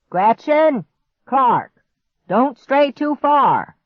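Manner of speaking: high, loud call across a yard. Acted. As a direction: call to the kids far off. Grandpa calls from afar.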